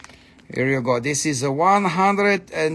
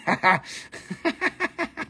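high staccato giggle; laugh; 12 semitones higher, 4 dB quieter than usual. Real, not acted. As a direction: burst into a long, high laugh. Laughing at own joke.

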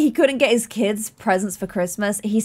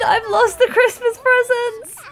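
much higher squeaky shriek of mock horror; hammed-up panic; 12 semitones higher, 3 dB louder, slower than usual. To the viewers as the presents vanish, a much higher mock panicked shriek. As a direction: shriek like you've ruined Christmas.

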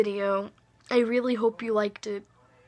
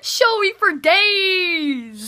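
loud, very slow drawn-out cry; hammed-up shout; 5 semitones higher, 9 dB louder, much slower than usual. Brother shouting praise at his sibling, hammed-up loud cry. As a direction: belt it out like a diva.

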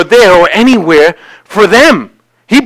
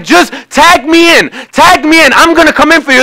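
urgent appeal; 7 semitones higher than usual. Acted. God urging from boxer's corner.

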